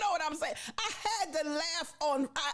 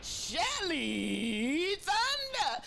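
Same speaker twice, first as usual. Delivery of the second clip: drawn-out name call; 3 semitones higher, much slower than usual. Real, not acted. Host announcing [name] to the room, stretched out. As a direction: announce her like an arrival. Calling out a guest.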